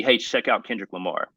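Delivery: ordinary delivery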